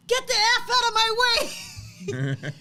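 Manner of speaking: high, loud, slow ready-to-fight yell; fierce shout. Retold or imitated. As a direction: bark it like a boy ready to fight. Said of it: "that's when Lincoln said".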